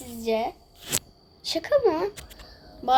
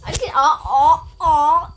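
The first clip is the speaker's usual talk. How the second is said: loud aa aa; thrilled exclaiming; excited surprise; 8 dB louder than usual. Real, not acted. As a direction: gasp with delight. New follower appears.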